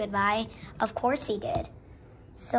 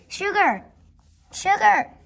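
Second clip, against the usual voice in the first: loud, high calls with falling tune; calling out; 6 semitones higher, 9 dB louder, slower than usual. Acted. Calling Sugar to take a place in the barn, loud high shout. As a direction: shout the name to fetch someone.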